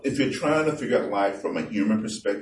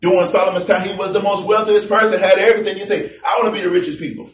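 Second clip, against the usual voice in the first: preaching at full force; 11 semitones higher, 8 dB louder than usual. Acted. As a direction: boom the verses out to the congregation. Preaching about riches.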